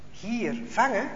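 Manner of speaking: soft, wondering repeat; puzzled echo. A narrator's reading. Jos quietly repeating the officer's call, baffled.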